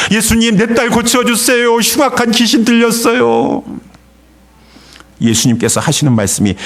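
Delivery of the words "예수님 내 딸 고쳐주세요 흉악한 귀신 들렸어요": high, unbroken desperate cry; crying out harder. Acted. Mother closing in behind Jesus, crying out for her daughter. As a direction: plead urgently, pitched high all the way through. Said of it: "더 소리 질렀습니다".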